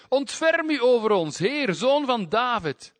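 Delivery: high, loud, slow pleading cry. Acted. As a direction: shout even harder over the crowd. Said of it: "Maar zij riepen des te meer".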